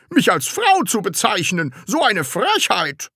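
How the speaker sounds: high-pitched outrage; indignant scolding. Acted. Teacher called a woman by pupil, scolding indignantly. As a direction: splutter with indignation. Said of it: "schimpfte Herr Groll".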